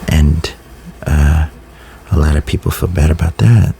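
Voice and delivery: normal talking voice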